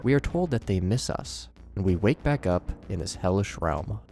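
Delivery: ordinary talk, nothing marked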